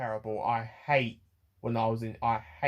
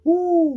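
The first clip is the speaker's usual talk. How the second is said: loud, high-pitched "ooh"; sudden high ooh of overwhelm; emotional whoop; 9 dB louder than usual. Real, not acted. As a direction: let out a big high exhale of feeling. Overcome by emotion while retelling.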